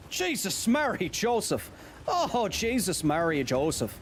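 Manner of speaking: high-pitched put-on Irish exclamation; Irish accent. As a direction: exclaim like a hammed-up Irish character. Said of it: "I can do an Irish accent".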